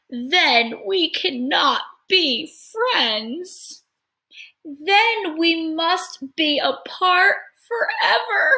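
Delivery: high, very slow lament; sad. Acted. Elephant sad at parting.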